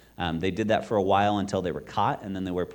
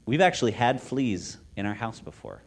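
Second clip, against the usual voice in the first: ordinary talk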